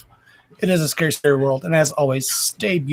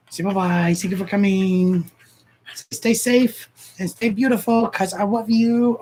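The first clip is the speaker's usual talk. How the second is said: higher, slower sing-song pet voice; 6 semitones higher than usual. Pretend. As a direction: speak for the dog in a high baby voice. Voicing the dog.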